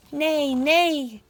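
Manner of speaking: loud, high horse call; drawn-out, playful horse voice; horse's neigh. Acted. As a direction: neigh out the words like a storybook horse. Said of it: "said the horse".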